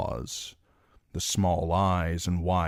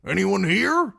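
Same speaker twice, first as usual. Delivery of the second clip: calling out; 7 dB louder than usual. A narrator's reading. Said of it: "called Nimble Dick".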